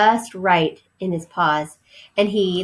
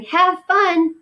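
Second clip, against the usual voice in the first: high mouse voice; 11 semitones higher, 4 dB louder than usual. Acted. Ralph answering Keith.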